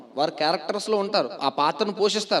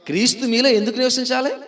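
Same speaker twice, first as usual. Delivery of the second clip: higher, raised voice pressing for an answer; insistent question; 7 semitones higher, 5 dB louder than usual. Real, not acted. Preacher prompts congregation to answer why Christ should dwell, insistent. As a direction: press the question on the listeners, pitched up. Asks again after prompting "ఆ?.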